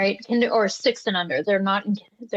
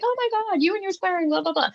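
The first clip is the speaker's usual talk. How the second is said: mocking outraged parent; 8 semitones higher, faster than usual. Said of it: "gonna come at me and be like".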